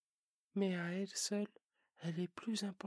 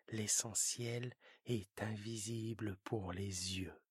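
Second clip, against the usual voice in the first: lower, slow fox stressing "est"; 11 semitones lower than usual. Acted. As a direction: fox voice, lean on "est" slowly. Fox says it, prince repeats.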